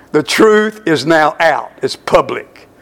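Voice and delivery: ordinary talk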